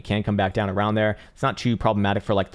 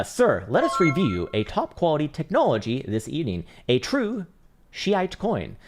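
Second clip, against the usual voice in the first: high, pompous mock-announcer voice, a tone higher; mock grand announcer read; 6 semitones higher, slower than usual. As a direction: read it like a grandiose infomercial host. Reading a viewer's name mockingly.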